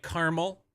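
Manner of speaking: "caramel" in two syllables, CAR-mul; own caramel pronunciation. Shown as a demonstration. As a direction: say "caramel" as carmel. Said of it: "I do caramel".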